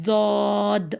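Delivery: long heavy زود like emphatic Zoay, no w; correct Daad. Shown as a demonstration. Teacher gives the correct letter, drawn-out heavy زود. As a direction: hold زود on one dark, full vowel. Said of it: "زوئے جیسی آواز دیتا ہے یہ".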